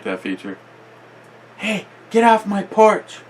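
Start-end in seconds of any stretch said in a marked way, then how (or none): 1.6-3.2 high, sharp yell off the porch; shouting at trespasser. Real, not acted. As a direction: chase them off with a high yell. Yelling at someone on porch.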